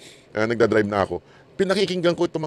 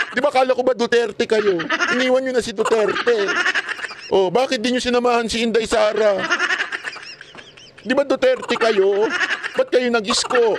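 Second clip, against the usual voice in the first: taunting; 8 semitones higher than usual. Real, not acted. Taunting former Duterte supporters.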